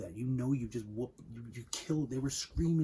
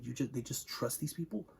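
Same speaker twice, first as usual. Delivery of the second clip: ordinary delivery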